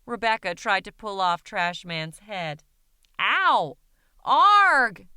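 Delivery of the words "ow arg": much louder, higher pained yell; huge scream. Acted. Trash man yelling as Rebecca pulls his head, loud scream. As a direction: shriek in pain. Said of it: "Rebecca let out a huge scream".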